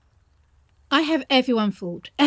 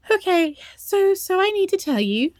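higher, strained with nerves; nervous high voice; 10 semitones higher than usual. Acted. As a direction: push through the fear to say it.

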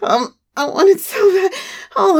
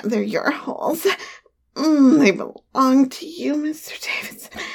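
ordinary delivery

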